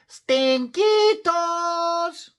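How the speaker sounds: very high, drawn-out loud call. A narrator's reading. Skippy John calls to his old amigos, calling out. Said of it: "called out Skippy John Jones".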